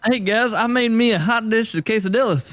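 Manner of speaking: high, mocking "hey guys" announcement. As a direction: announce it like a proud Minnesotan. Mocking hot dish naming.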